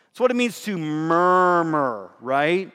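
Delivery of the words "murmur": long, slow "murmur"; onomatopoeic murmur. Shown as a demonstration. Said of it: "it sounds like what it is".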